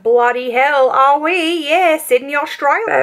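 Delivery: hammed-up fake Aussie accent; bad Australian accent. Fooling a stranger about being Australian, hammed-up bad Aussie accent. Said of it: "my shitty Australian accent".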